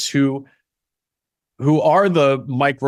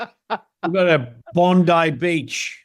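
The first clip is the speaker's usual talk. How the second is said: slow, higher, hammed-up Aussie; Australian accent; 4 semitones higher, much slower than usual. Shown as a demonstration. Actor hamming it up for the host, slow silly Australian accent. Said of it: "I do, like, Australian".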